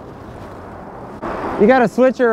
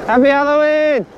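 cheerful call to passersby; passing cyclist calling out; 6 semitones higher than usual. Real, not acted. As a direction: shout it out while riding past. Calling out to passersby while riding.

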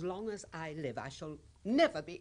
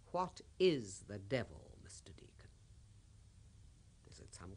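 whispered, fading to almost nothing; taunting whisper; 5 semitones lower, 4 dB quieter than usual. Acted. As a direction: lean in and whisper it. Taunting question to the priest.

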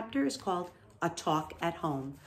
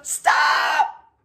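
piercing high scream, cutting someone off; 8 semitones higher, 8 dB louder than usual. A narrator's reading. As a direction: scream it in sheer panic. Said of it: "screamed Wilbur".

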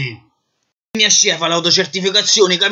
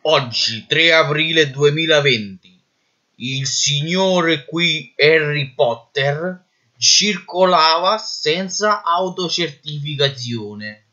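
slow dictation; much slower than usual. Acted. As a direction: dictate slowly for the record. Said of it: "Brigadiere, prendi nota".

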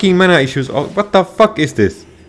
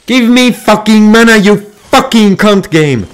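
high, loud raging yell; furious shout; 5 semitones higher, 7 dB louder than usual. Real, not acted. Gamer screaming at the game for mana, furious shout. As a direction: scream at the game in real fury.

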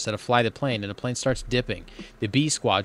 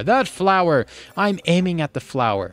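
urgent insistence; 6 semitones higher, 4 dB louder than usual. Acted. Urgent correction while landing the plane.

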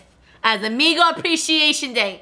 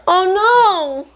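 sharp high gasp, then loud; startled oh no at a spill; gasp of alarm; 10 semitones higher, 5 dB louder than usual. Real, not acted. Coffee spilled on shirt.

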